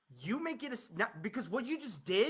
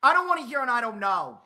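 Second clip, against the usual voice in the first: louder demand; loud fed-up demand; 9 dB louder than usual. Real, not acted. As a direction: raise the voice, fed up. Demanding answer from caller.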